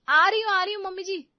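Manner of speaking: high, loud, harried answer; hurried call back. Acted. बहू पुकार का जवाब देती है. (हड़बड़ाकर ज़ोर से जवाब)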